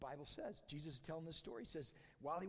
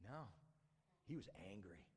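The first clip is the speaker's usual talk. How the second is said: low, soft statement of anger; understated reveal; 10 semitones lower, 11 dB quieter than usual. A narrator's reading. Preacher reveals the older brother's anger, understated. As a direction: drop low and quiet to state it.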